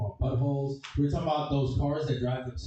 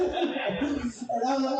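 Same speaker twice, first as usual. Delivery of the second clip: laughing; 11 semitones higher, much slower than usual. Real, not acted. Laughing at the punchline.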